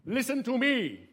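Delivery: loud, high proclamation to a crowd; herald's call. Acted. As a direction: call out for everyone's attention. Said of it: "They might say".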